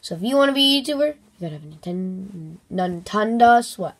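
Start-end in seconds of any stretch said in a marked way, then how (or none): none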